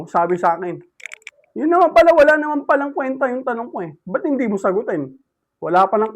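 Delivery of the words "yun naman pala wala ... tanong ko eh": sarcastic retort. Retold or imitated.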